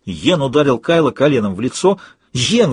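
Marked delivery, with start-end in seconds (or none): 2.3-2.7 indignant. Acted. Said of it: "возмутилась я".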